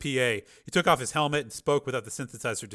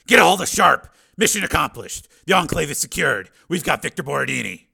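loud, raised, military report; commanding report; 4 semitones higher, 8 dB louder than usual. Acted. Steele tells his team the enclave is taken, loud command. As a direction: report the win forcefully.

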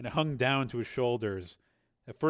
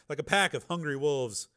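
ordinary delivery, nothing marked

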